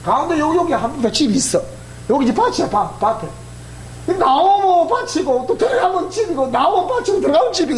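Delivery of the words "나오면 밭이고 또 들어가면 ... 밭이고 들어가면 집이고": higher, laughing lilt; playful laughing recital; mock singsong. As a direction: chant the dull routine. Mocking monotonous farm life.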